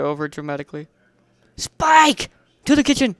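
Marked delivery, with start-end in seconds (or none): 1.6-3.1 loud, high rallying cry; rally him like a battle charge; dramatic call. A narrator's reading. Hero calling Spike to the kitchen, loud and dramatic.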